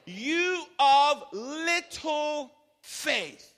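very slow, drawn-out and high; slow emphasis. Acted. Preacher stretching Jesus' rebuke for the crowd. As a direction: draw out every word. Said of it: "what, everybody?".

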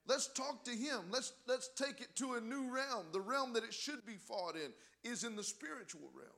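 high, loud rallying exhortation; full-force preaching. Real, not acted. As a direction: urge the church on at full voice.

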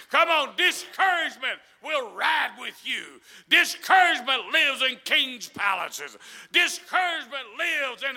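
loud, driving, slowed-out preacher's cadence; full-force preaching. Real, not acted. Preacher urging the church against discouragement, full-force shouted preaching. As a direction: preach it at a shout, building to the end.

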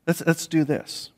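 lightly voiced patient teacher nudging them on; gentle Jesus encouraging. Jesus coaxing the disciples to the next step, gently. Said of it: "he goes".

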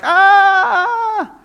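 a very high, very loud squeal of delight; excited child's shriek. Retold or imitated. A small child running to greet a loved one, shrieking with delight. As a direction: shriek like a little one spotting someone they love. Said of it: "they're so excited to see you".

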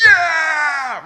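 huge joyful stadium shout; cheering yell. Retold or imitated. Fan cheering a goal, full-throated yell. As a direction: let it rip like a fan when his team scores. Cheering when points are scored.

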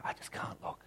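whispered, barely voiced; whispered despair. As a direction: whisper it, too afraid to look. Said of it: "and he goes".

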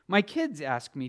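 ordinary delivery

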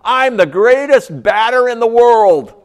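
put-on loud, high, slow boast like a proud little kid; kid shouting. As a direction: yell it to the whole yard like a kid sure of himself. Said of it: "he shouts at the top of his lungs".

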